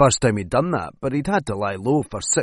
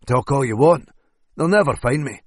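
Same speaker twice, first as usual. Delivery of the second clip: plain reading voice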